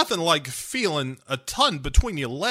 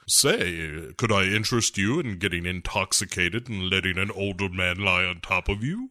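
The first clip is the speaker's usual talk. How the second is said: low mock Bond; 8 semitones lower, 4 dB quieter than usual. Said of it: "he's just like".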